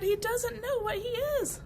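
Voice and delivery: ordinary delivery, nothing marked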